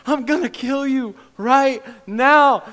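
loud, high, slow roar of threat; roaring dragon. Acted. Dragon threatens the son from behind, roaring. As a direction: bellow it like an attacking dragon. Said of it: "here's this dragon roaring".

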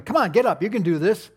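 encouraging dad. Retold or imitated. Dad coaching fallen child.

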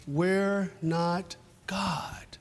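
slow whispered aside. Real, not acted. Preacher to church, hushing the point to a slow whisper. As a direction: drop to a hushed whisper.

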